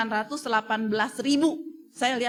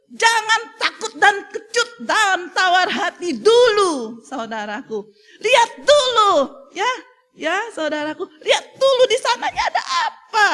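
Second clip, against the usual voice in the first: high, loud pleading with laughter and a catch of tears; preacher comforting the flock, emotional and loud; tearful exhortation; 10 semitones higher, 7 dB louder than usual. Acted. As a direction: urge them on, near tears and laughing. Exhorting the congregation.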